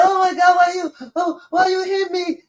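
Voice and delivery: high pleading why you hit me; hurt child. Retold or imitated. Child crying out to her father, high mock pleading. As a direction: whine like a kid being hit. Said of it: "She was like".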